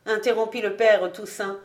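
usual speaking voice

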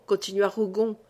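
usual speaking voice

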